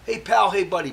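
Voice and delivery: cheery buddy greeting, lightly voiced; imitating cellmate's greeting. Cellmate Maury greeting Mike, lightly voiced chummy call.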